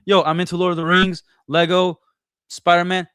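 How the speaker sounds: a mock enthusiastic opener to a stranger; excited pitch. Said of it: "I'm gonna be like".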